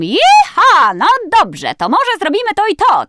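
high, loud cartoon pony voice; Applejack. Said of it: "wiem, że ona mówi tam".

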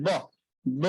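ব said with buzzing vocal cords, lips closed then released; voiced ব sound demo. Shown as a demonstration. Teacher contrasting voiced ব with ফ for students. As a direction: give ব full voice. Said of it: "vocal কর্ডটা কেঁপে উঠতেছে ব".

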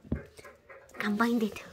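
ordinary talk, nothing marked

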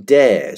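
slow, emphatic "dare"; stress on dare. Shown as a demonstration. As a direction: lean hard and long on "dare". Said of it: "emphasize the word dare here".

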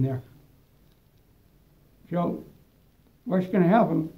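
talking around a cigar; cigar in mouth. Real, not acted. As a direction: lips clamped on cigar. Seen 0: Cigar clenched between lips throughout labelled words, hand holding it.

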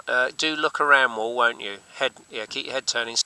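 normal talking voice

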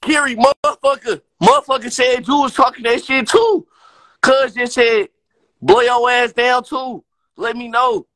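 shouting the name to get her attention; calling out. Real, not acted. Streamer calling out Kiri on live, loud.

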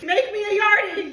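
high, loud patois shout; hammed-up Jamaican yell; mock patois. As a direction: call it out in a playful Jamaican accent. Playfully calls out in patois.

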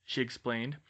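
usual speaking voice

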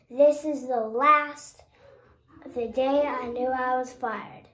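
usual speaking voice

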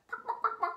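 short run of quick clucks; hen clucking. Shown as a demonstration. Host loving the hen's sound, quick clucking. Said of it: "sound that she makes".

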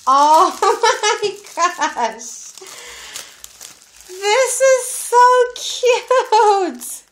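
high, loud squealing gush with laughs; thrilled high shriek over the gift; excited squeal. Real, not acted. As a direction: squeal with real delight, laughing. Thrilled by gift, daughter calling.

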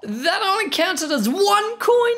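sudden high-pitched what; shocked disbelief; surprised. Real, not acted. Shocked at coin count.

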